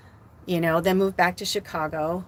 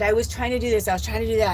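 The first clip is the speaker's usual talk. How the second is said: normal talking voice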